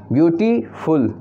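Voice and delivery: Beautiful said slowly, syllable by syllable with small breaks; split into syllables. Shown as a demonstration. Teacher showing students the syllables, slowly sounded out. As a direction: pause between the sounds of beautiful. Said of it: "रुक कर बोल रहे हैं".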